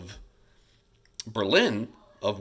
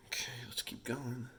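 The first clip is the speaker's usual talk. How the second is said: whispered aside to himself; murmured note to self, slides; 2 semitones higher, 13 dB quieter than usual. Real, not acted. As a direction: breathe it under your breath while clicking ahead. Aside while changing slides.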